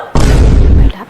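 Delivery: loud, shocked cry; shouted address. Acted. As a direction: call out sharply in protest. Aunty reacts to being ordered out.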